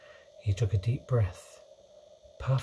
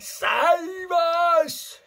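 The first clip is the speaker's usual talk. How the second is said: loud, very high plea; screamed; 6 dB louder than usual. A narrator's reading. Sleeping giant begging for rescue in a nightmare, screaming. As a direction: scream for help with drawn-out vowels. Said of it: "screamed the flesh lump eater".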